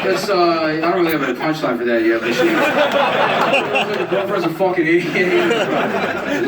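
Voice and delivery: high, loud awkward comic; mock comic voice. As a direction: play the clueless open-miker. Acting out bad comic set.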